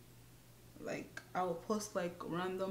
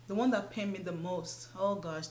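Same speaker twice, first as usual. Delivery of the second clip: usual speaking voice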